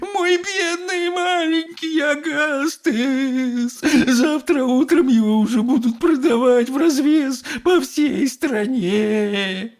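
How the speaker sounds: long drawn-out high wail; inconsolable sobbing. A narrator's reading. Mother mourning her little boy, sobbing. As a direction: wail slowly, stretching the last words. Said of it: "зарыдала безутешная миссис Глуп".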